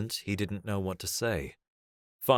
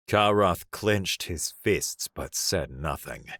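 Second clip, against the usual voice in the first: plain reading voice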